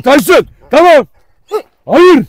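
high, loud single-word shouts; shouted commands. Real, not acted. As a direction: shout to control the dog. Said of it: "Ses bırakmadınız bende bağıra bağıra". (bağırarak uyarma)

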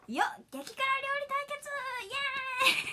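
high-pitched mock MC cheer; show MC. MC opening a super-spicy cooking showdown, mock hype. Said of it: "みたいな時の司会で".

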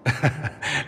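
a short, soft chuckle; soft amused chuckle. Acted. Dorian chuckles softly at the Inquisitor's blunt reply. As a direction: chuckle quietly at his bluntness.

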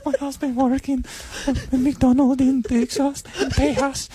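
put-on Mexican accent. Wife bragging about husband's McDonald's pay, hammed-up Mexican accent.

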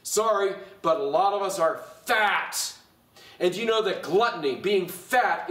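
emphatic, driving sermon delivery; forceful preaching. Acted. Pastor scolding his congregation over gluttony, forceful preaching. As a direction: preach it hard, hitting the key words.

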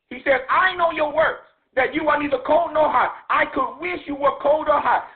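ordinary talk, nothing marked

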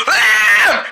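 high scream of panic; panicked scream. Acted. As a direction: scream in fright. Kids panic at being lost.

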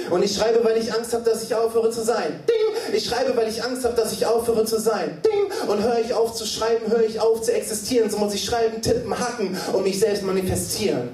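high, driving chant-like refrain; insistent high recitation; urgent slam refrain. Acted. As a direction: punctuate with piercing typewriter dings. Performs poem refrain.

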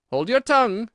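loud, high reprimand; snappish Gryphon. A narrator's reading. Gryphon cutting Alice off, loud and high. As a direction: silence them loudly.

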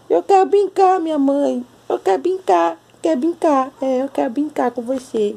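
high, squeaky cat voice wanting to play; voicing the cat. Voicing the cat begging its mom to play, high squeaky voice. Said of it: "por que você fala com essa voz tão ridícula".

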